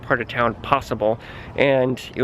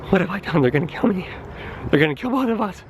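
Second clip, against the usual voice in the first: rising higher into hammed-up dread; mock panic; 6 semitones higher, faster than usual. Narrator thinking the tow staff will kill them, hammed-up rising panic. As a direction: spiral into playful panic. Inner panic after refusing cash.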